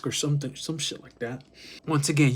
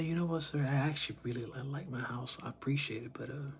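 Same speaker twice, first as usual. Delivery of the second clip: much softer, unbothered polite refusal; casual; 6 dB quieter than usual. Acted. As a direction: brush the offer off quietly and casually. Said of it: "And you just casually say".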